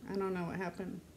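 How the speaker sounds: ordinary talk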